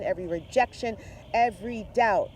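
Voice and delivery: normal talking voice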